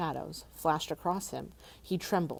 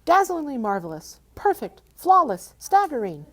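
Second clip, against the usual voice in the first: much higher, loud mock awe; exclaiming; 9 semitones higher, 10 dB louder than usual. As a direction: gush over the dragon's armor. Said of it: "exclaimed Bilbo aloud".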